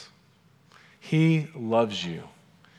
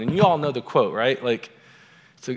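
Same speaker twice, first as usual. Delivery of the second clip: ordinary talk, nothing marked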